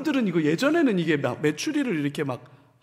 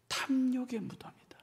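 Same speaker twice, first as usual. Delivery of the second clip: whisper; 5 semitones higher, 10 dB quieter than usual. Real, not acted. Solemn naming of place.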